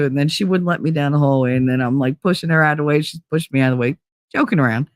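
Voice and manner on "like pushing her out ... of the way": usual speaking voice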